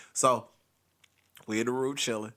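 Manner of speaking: usual speaking voice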